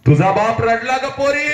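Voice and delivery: high, ringing declamation; soaring pitch with held ending; impassioned cry. Acted. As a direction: cry it out to the daughter. Same line cried out high.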